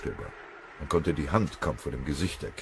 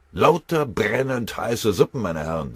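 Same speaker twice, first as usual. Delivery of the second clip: sighing; 6 semitones higher, 6 dB louder than usual. A narrator's reading. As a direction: stretch it out like a longing sigh. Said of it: "seufzte der Oberfeldwebel". (gedehnt, seufzend)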